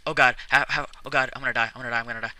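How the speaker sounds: loud real panic, fading; panicked. Real, not acted. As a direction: gasp it out in panic. Panicking while attacked in game.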